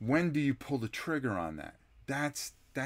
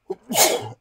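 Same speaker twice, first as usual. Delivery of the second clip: one sudden loud sneeze; real sneeze in the middle of talking; sneezing; 10 semitones higher, 10 dB louder than usual. Real, not acted. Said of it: "Excuse me, I'm gonna sneeze".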